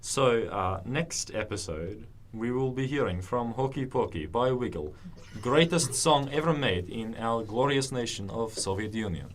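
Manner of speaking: put-on Russian-accented radio presenter. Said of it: "can you do it in your Russian accent".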